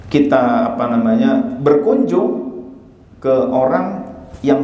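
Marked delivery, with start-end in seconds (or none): none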